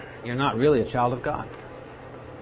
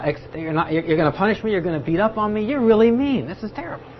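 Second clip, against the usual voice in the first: high, whiny, petulant complaint; aggrieved petulant protest, pitched high; petulant whining; 5 semitones higher than usual. Retold or imitated. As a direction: whine like a sulking child at God. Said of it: "He is saying, rather, very petulantly".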